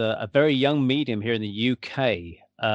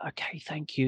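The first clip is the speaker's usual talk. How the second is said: much softer, hushed thanks; retold whisper; 2 semitones higher, 9 dB quieter than usual. Latecomers thanking the doorman mid-reading, hushed mock whisper. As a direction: murmur it so you don't disturb anyone. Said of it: "you know, whispering".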